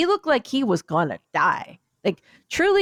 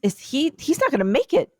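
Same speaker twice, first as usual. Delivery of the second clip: higher-pitched, retold alarm; imitated shocked onlookers, pitched up; collective shock; 5 semitones higher than usual. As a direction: act out a stunned viewer's rising panic. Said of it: "there was a collective shock".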